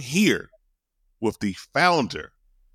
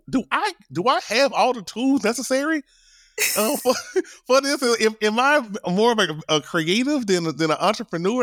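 high questioning voice; 12 semitones higher than usual. Retold or imitated. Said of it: "I was like".

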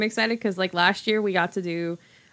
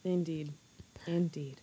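solemn hushed indeed; whispered; 8 dB quieter than usual. Acted. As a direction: murmur it gravely. Mock solemn agreement.

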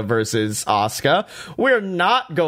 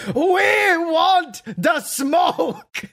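much higher, very slow mock shout; imitated wrestler shout; 13 semitones higher, much slower than usual. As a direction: belt it out like a hyped-up wrestler. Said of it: "scream in Japanese before going".

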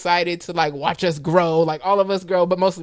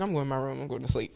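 tired retreat; 5 semitones lower, 11 dB quieter, faster than usual. Retold or imitated. Imitating tired family days.

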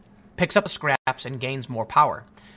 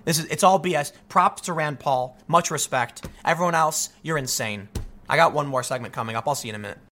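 usual speaking voice

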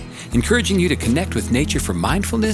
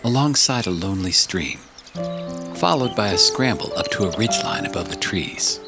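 audiobook narration. Acted. Reads audiobook chapter.

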